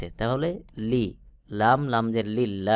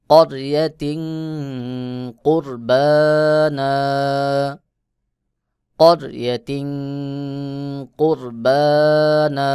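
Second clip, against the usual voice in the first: heavy r recitation; 2 semitones higher, 5 dB louder, much slower than usual. Shown as a demonstration. Teacher recites for students, loud, slow and chanted. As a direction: chant করিয়াতিন কুরবানা with a heavy র, long vowels. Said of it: "রকে মোটা করে উচ্চারণ করতে হবে".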